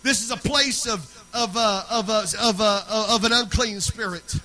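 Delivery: loud, driving sermon delivery; full-force preaching. Real, not acted. Preacher urging the church to praise and pray, loud forceful preaching.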